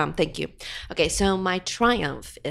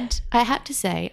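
ordinary delivery

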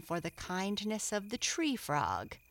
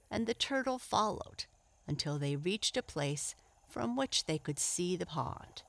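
usual speaking voice

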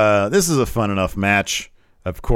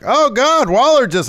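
high, loud imitation of shocked commentators; mock shocked exclamation; 9 dB louder than usual. Imitating commentators reacting to Waller, shocked. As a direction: cry out as if reporting breaking chaos. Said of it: "and then they're like".

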